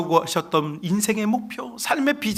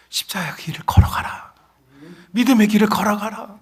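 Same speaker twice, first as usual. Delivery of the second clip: rising to a louder, higher firm command; Jesus's voice; 5 semitones higher, 6 dB louder than usual. Retold or imitated. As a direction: call the children onward with authority. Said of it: "이게 예수님의 음성이에요". (점점 크고 높아지는 예수님의 단호한 음성)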